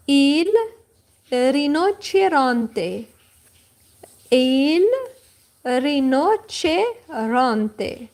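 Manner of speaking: slow model. Shown as a demonstration.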